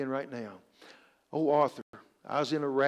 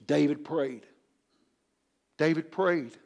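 ordinary delivery

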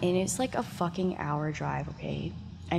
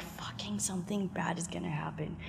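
much softer whispered foreboding; dread whisper; 11 dB quieter than usual. Retold or imitated. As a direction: whisper the fear to yourself. Inner dread before the flight.

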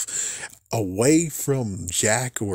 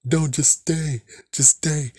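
higher, slow, lightly hammed-up plea; mock pleading dad; 5 semitones higher, slower than usual. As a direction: beg him to stay, overdone. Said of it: "but it's like".

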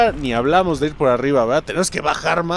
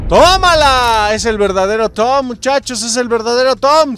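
excited; 8 semitones higher, 7 dB louder than usual. Real, not acted. Triumphant reveal in game.

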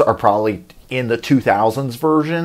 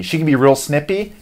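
normal talking voice

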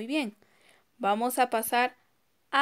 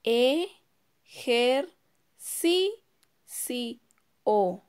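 "E, ger, si, si, o." in five separate beats; teacher counting ejercicio aloud; syllable by syllable; much slower than usual. Shown as a demonstration. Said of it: "A ver, contemos".

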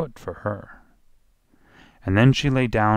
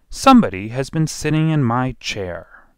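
lightly voiced middle bear, raised and louder; 4 semitones higher, 6 dB louder than usual. A narrator's reading. Middle bear finds his chair used, loud middle voice. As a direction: speak up as the middle-sized bear. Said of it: "in his middle voice".

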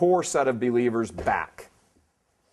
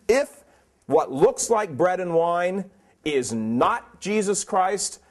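fervent preaching; 5 semitones higher, 3 dB louder than usual. Real, not acted. Preaching, raised voice.

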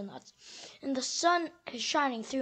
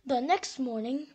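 plain reading voice

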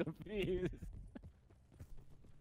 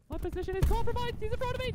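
panicking gamer; 14 semitones higher, 6 dB louder, much faster than usual. Real, not acted. Enemy in front, rushed callout.

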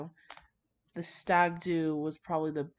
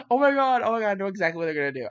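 excited realisation; 2 semitones higher, 7 dB louder, much faster than usual. Real, not acted. Reader guessing the twist, loud excited rush. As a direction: blurt it out, thrilled and fast.

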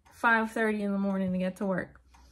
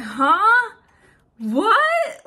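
loud, high huh then what; stunned disbelief; 8 semitones higher, 8 dB louder than usual. Retold or imitated. Hearing from her sister that dad died, loud stunned disbelief. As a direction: blurt it out, shocked and high.

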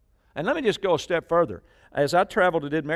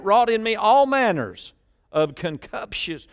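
preaching at full force; 7 semitones higher, 7 dB louder than usual. Acted. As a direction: proclaim the verse to the whole church. Reads scripture at full force.

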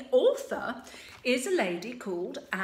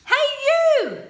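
high, loud hail; calling out; 14 semitones higher, 12 dB louder than usual. Acted. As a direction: shout up to the dragon. Sue calling to dragon.

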